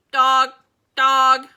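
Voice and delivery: loud squawking screams; screaming parrot. A narrator's reading. Gertrude the parrot screams at the dog, loud squawk. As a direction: shriek it at the dog twice. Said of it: "Gertrude kept screaming".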